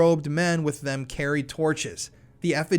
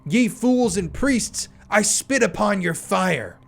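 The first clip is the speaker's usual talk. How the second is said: mocking spirit; 5 semitones higher, 4 dB louder than usual. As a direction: sneer down at the fools. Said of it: "monstrous evil laughter".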